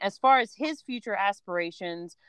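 normal talking voice

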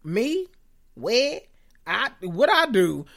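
mock bewildered innocence, slowing at the end; confused Crystal. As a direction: play her shocked and lost. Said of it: "Crystal like".

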